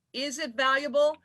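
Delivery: normal talking voice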